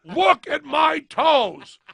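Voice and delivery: hammed-up furious yell at the driver; shouted demand. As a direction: bellow it, dragging each word. Tyler demanding in story.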